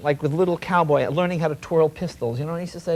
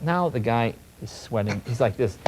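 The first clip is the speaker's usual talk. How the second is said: ordinary talk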